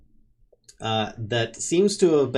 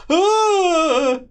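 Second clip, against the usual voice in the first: high loud howl from behind the door; distant howl of someone fleeing; 8 dB louder than usual. Acted. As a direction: let out a shrill howl through the wall. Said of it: "a howl of somebody, sounding like".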